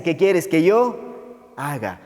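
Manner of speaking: drawn-out "yo haga"; very slow question, lingering on "yo" before "haga"; stress on YO HAGA. Acted. Recalca la pregunta de Pablo. ("yo haga" alargado y recalcado)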